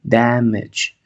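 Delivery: "Damage" ending in a short e, dam-ech. Shown as a demonstration. Teacher repeating the word, short e. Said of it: "Una E corta".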